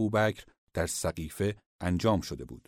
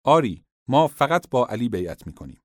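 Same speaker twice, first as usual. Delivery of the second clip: louder and higher, a crowd's firm cry; raised voice pledging loyalty; loud declaration; 4 semitones higher, 8 dB louder than usual. Acted. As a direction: call it out for all to hear. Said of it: "با صدای بلند گفتند".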